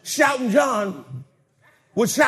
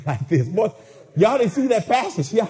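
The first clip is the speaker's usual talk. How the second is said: ordinary talk, nothing marked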